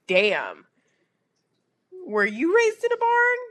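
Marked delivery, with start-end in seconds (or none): 2.1-3.4 mocking high voice. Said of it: "repeats the man's line".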